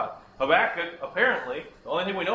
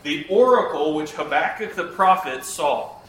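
slow, solemn Bible reading; scripture reading; 5 dB louder than usual. Acted. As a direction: read it out like holy scripture.

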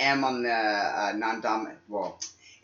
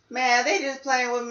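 imagined skeptical listener; 12 semitones higher, 4 dB louder than usual. Retold or imitated. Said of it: "I'd be like".

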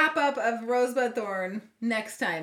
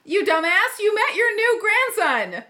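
loud, higher playful outburst; mock outrage; 5 semitones higher, 5 dB louder than usual. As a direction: tease him loudly for hiding big news. Teasing friend about hidden news.

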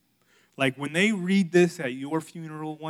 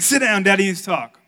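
loud, higher order; mock stern dad; 4 semitones higher, 13 dB louder than usual. Dad sitting his kids down to talk, mock stern. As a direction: bark it like dad, hammed up. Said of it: "He's like".

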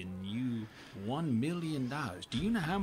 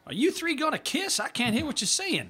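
high heckling shout; 11 semitones higher, 4 dB louder than usual. Acted. Father heckling louder.

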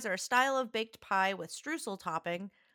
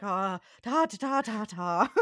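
botched throaty go at French; hammed-up attempt at French pronunciation; mock French attempt; 3 dB louder than usual. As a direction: fumble the French syllables, exaggerated. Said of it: "and I'm like".

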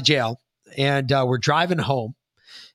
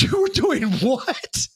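incredulous dad; 11 semitones higher, slower than usual. Retold or imitated.

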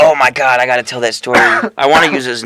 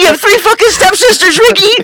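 very high, louder mock shriek; retold screaming freak-out; 4 dB louder than usual. Friend repeats the woman's outburst at Ricky, shrieked higher in mock fury. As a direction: escalate into a screechy imitation, cracking into laughter at the end. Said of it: "she was freaking the fuck out".

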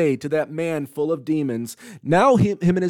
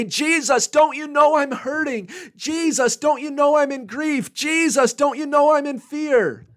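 high, pleading cry to Jesus; desperate plea; 12 semitones higher than usual. Acted. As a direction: cry out like someone who is hurting and afraid. Disciples crying out to Jesus.

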